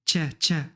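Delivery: "tcha" with a full ch after the T; Casablanca-style tch. Shown as a demonstration. Teacher comparing with Casablanca speech, strongly affricated "tcha". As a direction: press the T of "tcha" into a clear tch. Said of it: "Bhal kazawa".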